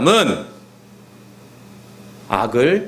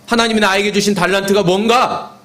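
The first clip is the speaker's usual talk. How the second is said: raised, insistent pulpit delivery; preaching exhortation; 8 semitones higher than usual. Acted. Preacher urging the congregation to build faith routines, exhorting. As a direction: drive each phrase home in a higher voice.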